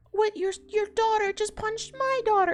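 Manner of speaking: high, indignant mock dad voice; outraged dad. Alan protesting to Danny that his daughter was punched, high and indignant. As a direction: squeal it like an outraged father. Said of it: "And Alan's like".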